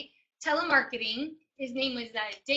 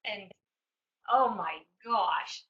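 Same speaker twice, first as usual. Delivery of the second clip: ordinary delivery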